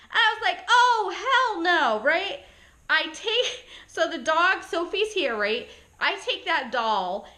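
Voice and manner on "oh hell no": higher, louder, drawn-out refusal; emphatic refusal. Retold or imitated. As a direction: stretch each word in retold horror. Retold refusal on seeing doll.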